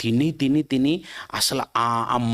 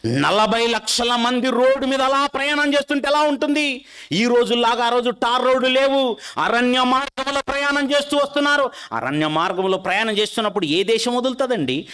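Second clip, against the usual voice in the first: preaching at full force; 10 semitones higher than usual. Acted. Preacher picturing the crowd for his listeners, ringing and raised. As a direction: proclaim it to the whole congregation.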